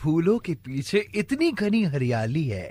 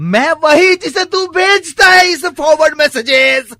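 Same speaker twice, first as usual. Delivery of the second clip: loud, mounting mock outrage; mock indignation; 4 semitones higher, 9 dB louder than usual. Prank caller confronting a message forwarder, mock indignation. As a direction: raise the voice as if fed up.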